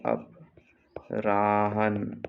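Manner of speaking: अपराहन drawn out slowly, syllable by syllable; dictating अपराहन very slowly; slow dictation. Shown as a demonstration. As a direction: stretch अपराहन for the class to write down. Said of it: "अपरान होता है".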